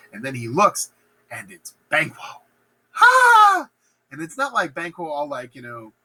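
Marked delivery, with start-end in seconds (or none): 2.9-3.7 high, loud comic gasp; mock horrified gasp. Macbeth seeing murdered Banquo in his chair, mock horrified gasp. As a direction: gasp in exaggerated shock.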